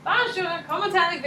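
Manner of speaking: high, chirpy amateur French; enthusiastic French greeting. Retold or imitated. American greeting people in France, high enthusiastic French. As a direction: pitch it up with tourist enthusiasm. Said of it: "that's literally how I said it every time".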